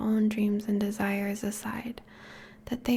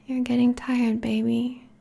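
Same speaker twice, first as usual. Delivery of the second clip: ordinary talk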